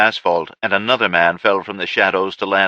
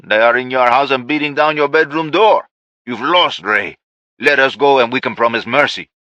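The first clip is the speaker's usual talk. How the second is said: firm negotiator; 5 semitones higher, 4 dB louder than usual. Acted. Andrew bargains with Ray.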